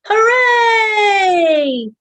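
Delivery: high, loud joyful shout; shouted hooray; big cheer. Shown as a demonstration. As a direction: cheer it out with the kids. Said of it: "let's shout".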